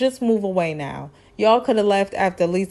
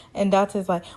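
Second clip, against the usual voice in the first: usual speaking voice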